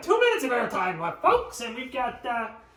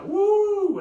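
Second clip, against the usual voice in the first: a sudden loud, high whoop; excited whoop; 11 semitones higher, 8 dB louder than usual. Real, not acted. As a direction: cheer it out like a crowd. Cheering the arm reveal.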